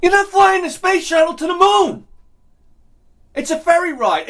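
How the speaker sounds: high, loud exasperated rant; furious rant at ferry clappers. Real, not acted. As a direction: yell it like you've had enough. Ranting at clapping passengers.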